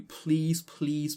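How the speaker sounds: ordinary delivery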